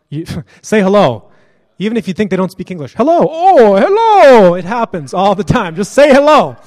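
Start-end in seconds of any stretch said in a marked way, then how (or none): 2.9-4.5 much higher, louder, drawn-out hello; cheerful greeting. Pretend. Greeting a stranger on the street with over-the-top cheer. 5.7-6.6 emphatic urging. Real, not acted. Urging listeners.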